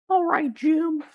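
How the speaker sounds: very high squeaky cartoon voice; Carl Wheezer. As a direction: imitate a squeaky cartoon character. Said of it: "Carl Wheezer".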